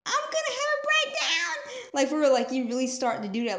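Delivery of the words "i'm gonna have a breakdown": very high, strained-sounding wail; hammed-up panic way up high; mock breakdown. Speaker acts out someone overwhelmed, high mock panic.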